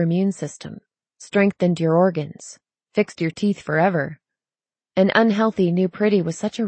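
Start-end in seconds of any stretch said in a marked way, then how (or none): none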